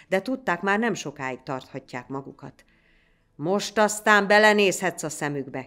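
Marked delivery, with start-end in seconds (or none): 3.3-5.7 loud, raised-pitch call to a comrade; shouted taunt. A narrator's reading. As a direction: yell it over the fighting. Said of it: "kiáltotta Beke a társának".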